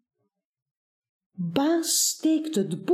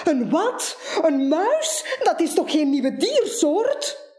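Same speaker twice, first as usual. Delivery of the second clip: shriek; 7 semitones higher than usual. Acted. Buur, jumping around, shrieks at Baas about the mouse, high and laughing. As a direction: squeal it out, half laughing, half alarmed.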